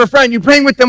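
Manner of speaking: ordinary talk, nothing marked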